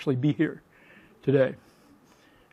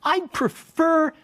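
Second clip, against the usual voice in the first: high, slow mock disbelief; incredulous; 12 semitones higher, much slower than usual. Professor answers his dean, high slow incredulous protest. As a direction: stretch it out in outraged disbelief. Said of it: "I said".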